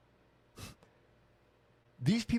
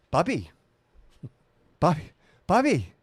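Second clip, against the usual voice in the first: much higher, louder put-on voice of an old mom calling her son; imitating mom; 9 semitones higher, 7 dB louder than usual. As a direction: ham up your eighty-year-old mom nagging Bobby. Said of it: "That's a terrible accent of my mom".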